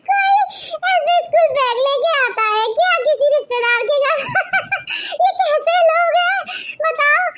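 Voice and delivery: high-pitched talk broken by giggling fits; laughing hard. Acted. Daughter-in-law ridiculing guests' school bag, laughing hard. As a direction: barely get the words out for laughing. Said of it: "क्यों हंस रही है इतनी जोर जोर से".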